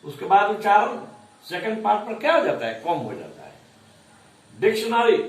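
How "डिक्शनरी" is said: "Dictionary" with a loud, high डिक; first-part stress. Shown as a demonstration. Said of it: "डिक पर अधिक उच्चारण है".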